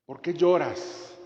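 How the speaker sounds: slow, tender question; gentle Jesus question. Acted. Jesus gently asking Mary Magdalene, slow and tender. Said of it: "las dos preguntas que hizo Jesús".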